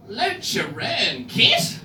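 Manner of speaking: "Lucha Kit" stretched very slowly as a question; puzzled cop lady. Retold or imitated. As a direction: sound out "Lucha Kit" haltingly, like a baffled officer. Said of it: "And then she did this".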